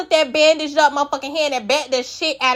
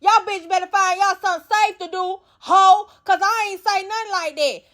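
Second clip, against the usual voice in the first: high put-on cussing-out voice; imitating an angry tirade, pitched up; going off; 5 semitones higher than usual. Dolly going off on Russian and Elsie, mock angry. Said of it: "Dolly get to going out".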